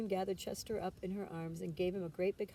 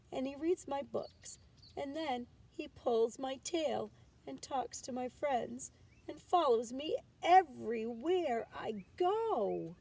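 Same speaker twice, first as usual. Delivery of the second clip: put-on a higher whiny little-kid voice, slowing down; complaining child; 6 semitones higher, 3 dB louder than usual. A narrator's reading. Chester complains to his mother, higher child voice. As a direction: list every grievance like a fed-up kid.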